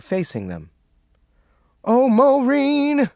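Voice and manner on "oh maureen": high, slow sobbing cry; drawn-out sob of the name. A narrator's reading. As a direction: sob to Maureen.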